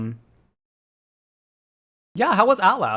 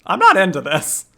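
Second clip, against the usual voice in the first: loud, higher-pitched objection; mock protest; 4 semitones higher, 8 dB louder than usual. Streamer refusing a game character's advance, loud mock protest. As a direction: protest firmly, hamming it up.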